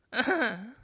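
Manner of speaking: a short burst of laughter; a teary laugh breaking through; sudden laugh. Acted. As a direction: laugh out before you can stop it. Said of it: "What's making you laugh like that?".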